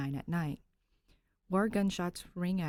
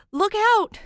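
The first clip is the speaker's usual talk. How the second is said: sudden high yell of alarm; shouted warning; 15 dB louder than usual. Acted. Ghost warning Danny of the gunman, a sudden high shout. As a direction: scream a warning to duck. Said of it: "before he shouted".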